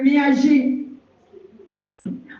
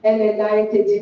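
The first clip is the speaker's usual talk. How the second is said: normal talking voice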